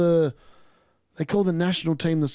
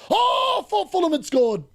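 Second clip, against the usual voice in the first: very high, startled cry at a goal; excited goal shout; 13 semitones higher, slower than usual. Real, not acted. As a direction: break off and yell as it seems to go in. Sudden shout at an apparent goal.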